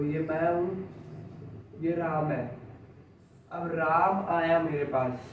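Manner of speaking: ordinary delivery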